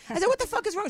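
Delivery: high, loud mock demand; exasperated retort. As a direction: replay an incredulous challenge, voice shooting up. Acts out her exasperated challenge.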